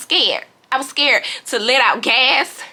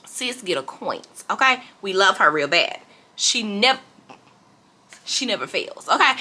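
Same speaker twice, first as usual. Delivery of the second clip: ordinary delivery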